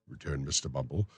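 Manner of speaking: plain reading voice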